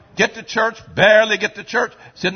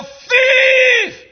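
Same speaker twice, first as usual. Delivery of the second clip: high shouted accusation; accusing cry; 6 dB louder than usual. Real, not acted. Preacher branding the sinner, shouted high. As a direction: hurl the word at them.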